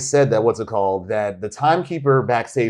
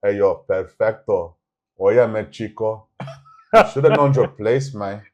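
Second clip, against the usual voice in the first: fake Cuban accent; 3 semitones lower than usual. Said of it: "still in that fake Cuban accent".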